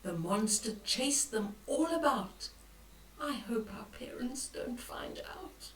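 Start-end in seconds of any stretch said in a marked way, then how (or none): 3.2-5.7 whispered, much softer and lower; worried whisper. Acted. Boy whispering his fear about parents finding out. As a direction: hiss the worry very low.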